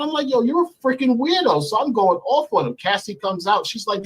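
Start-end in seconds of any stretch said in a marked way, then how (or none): 0.3-1.6 high-pitched angry insult; indignant, acted-out jab; retold cursing out. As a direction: act out telling someone off, voice raised in pitch. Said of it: "I start cursing him out".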